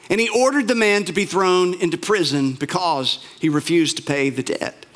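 usual speaking voice

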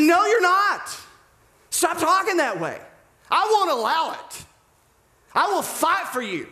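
high, forceful alpha insistence; bold, brash protest. Acted. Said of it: "he's strong, he's brass, he's bold, he's alpha".

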